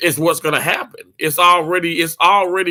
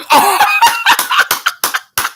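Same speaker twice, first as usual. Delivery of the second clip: a sudden squeal of laughter, much higher; 6 dB louder than usual. Real, not acted. Streamer squeals with laughter at a viewer's joke, high squealing laugh. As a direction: shriek with delight at a chat comment.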